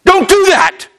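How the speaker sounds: a father begging his son to stop; pleading father. Retold or imitated. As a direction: Solomon warning his sons, high and urgent. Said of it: "And he's saying".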